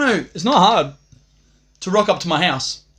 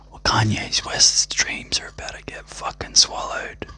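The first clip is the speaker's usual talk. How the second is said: hushed, slow whispered threat; 6 semitones lower, 3 dB quieter than usual. Acted. Teasing his viewers with a slow whispered threat. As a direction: breathe it out slowly, barely voiced, as a menacing secret. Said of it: "Did I say it loud enough?".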